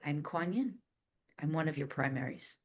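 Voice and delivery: softly voiced spirit being; hint of an otherworldly visitor, hushed and measured; Kuan Yin. Ascended master introducing herself to a new channeler, soft imitated voice. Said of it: "I hear".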